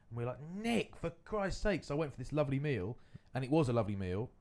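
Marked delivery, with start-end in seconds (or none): 0.6-1.8 mock exasperated scolding. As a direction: stretch it out high, as if appalled at a mate. Friends chide the swearer, retold.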